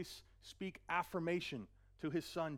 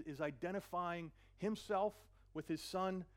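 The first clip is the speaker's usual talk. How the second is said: normal talking voice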